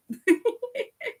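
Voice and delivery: real high-pitched chuckle; high giggle. Real, not acted. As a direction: let out a high, light giggle. Laughs at her own remark.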